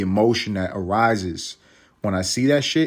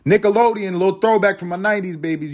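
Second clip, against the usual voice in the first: excited shoutout; 10 semitones higher, 5 dB louder, faster than usual. Real, not acted. Hyped callout to nineties kids.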